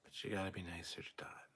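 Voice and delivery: hushed whisper aside. Real, not acted. Streamer whispering to chat to be nicer to Dot. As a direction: lean in and whisper it.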